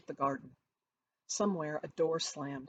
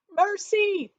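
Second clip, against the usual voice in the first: terrified high scream; 10 dB louder than usual. A narrator's reading. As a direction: shriek for mercy. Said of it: "he screamed".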